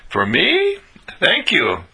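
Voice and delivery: high, surprised question, then grateful; incredulous delight. Acted. Showing how to receive a gift, incredulous delight. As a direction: marvel that it's all yours. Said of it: "We are here to be incredulous".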